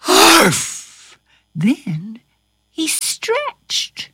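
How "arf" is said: loud, higher yelp; dog bark. A narrator's reading. Carpenter waking as a dog, barked "arf". Enchanted man yawns like a dog.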